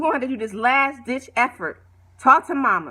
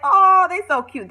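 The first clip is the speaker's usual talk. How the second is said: higher and louder, delighted coo; gushing; 7 semitones higher, 6 dB louder than usual. Retold or imitated. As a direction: swoon over the couple. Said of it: "and they like".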